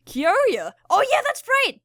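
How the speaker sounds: loud, high burst of remembering; oh right, suddenly remembering; sudden realization. Real, not acted. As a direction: blurt it out as it hits you. Sudden recall of forgotten character.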